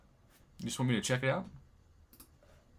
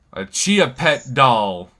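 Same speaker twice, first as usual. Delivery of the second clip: slow, drawn-out Texas twang, a bit louder; 9 dB louder, much slower than usual. Pretend. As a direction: stretch it out in a put-on Texas twang. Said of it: "using this accent here".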